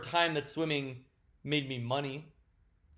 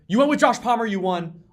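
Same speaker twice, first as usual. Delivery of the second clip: higher and louder, disbelief rising; shocked realization; 9 semitones higher, 11 dB louder than usual. Real, not acted. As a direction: blurt it out in shock. Realizes the bench would have won.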